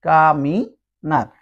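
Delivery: "কা, মি, নার" in three separate syllables. Shown as a demonstration. Teacher builds a Spanish word for learners, syllable by syllable. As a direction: sound out "কা, মি, নার" with gaps. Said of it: "এটা সবসময় আর মতো উচ্চারণ হবে".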